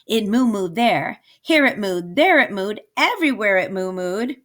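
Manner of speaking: ordinary delivery, nothing marked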